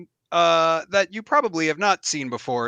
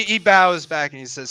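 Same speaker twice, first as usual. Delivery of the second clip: ordinary delivery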